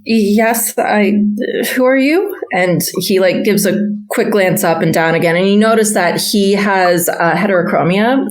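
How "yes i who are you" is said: jumpy, stammering, higher; startled stable boy. Retold or imitated. Stable boy surprised by a stranger, stammering higher. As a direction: caught off guard, eyes down. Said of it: "jerks instinctively and looks down and says".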